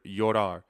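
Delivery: "llorar" without a J, starting like "yor"; no J sound. Shown as a demonstration. Teacher repeating the exception for learners, no J sound. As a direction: say "llorar" slowly as "yorar", not "jorar". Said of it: "does not produce the J sound".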